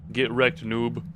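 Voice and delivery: ordinary delivery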